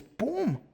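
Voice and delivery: high-pitched vocal "boom"; imitated thud. Preacher marks the revelation hitting Saulo, high imitated boom. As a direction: punch out a high mock impact.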